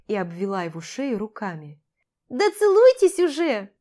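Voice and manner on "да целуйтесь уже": loud, high, impatient outcry; indignant exclamation. A narrator's reading. Boy urging the newlyweds to kiss, indignant shout. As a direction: burst out with it, high and exasperated. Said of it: "возмущённо воскликнул Ярослав".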